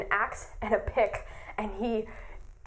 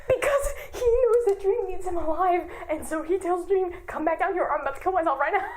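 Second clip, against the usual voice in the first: laugh-crying, high; can barely get it out for laughing; cracking up while retelling; 6 semitones higher than usual. Real, not acted. Laughing at Tommy's threat.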